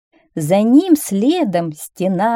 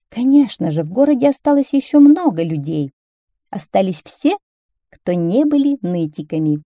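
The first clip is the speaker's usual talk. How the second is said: ordinary delivery, nothing marked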